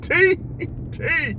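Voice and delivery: high-pitched call for a cousin; screaming a name. Retold or imitated. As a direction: cry out the name for help. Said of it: "started screaming out my cousin name".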